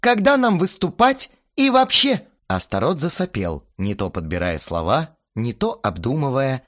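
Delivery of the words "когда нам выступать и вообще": high, loud, indignant questioning; heated demand. A narrator's reading. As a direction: demand it in a raised voice. Heated band quarrel. (возмущённо, на повышенных тонах)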